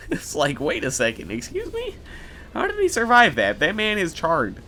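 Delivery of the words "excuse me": normal talking voice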